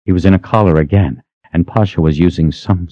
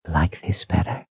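soft, breathy seductive whisper; 10 dB quieter than usual. A narrator's reading. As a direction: breathe the words out softly. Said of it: "she asked in a breathy voice".

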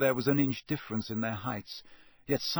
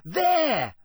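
high, loud excited shout; yell; 13 semitones higher, 6 dB louder than usual. A narrator's reading. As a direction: yell it out as you spot it. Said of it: "yelled a sixth year".

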